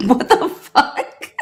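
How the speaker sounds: slow, drawn-out laughing what the; laughing disbelief. Retold or imitated. Reacting to friend's Glocks, slow laughing disbelief. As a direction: stretch it out while cracking up.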